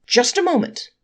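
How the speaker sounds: defending angel cries out to halt the court; cry out. Acted. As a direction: shout to stop the verdict. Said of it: "cried the angelic counsel".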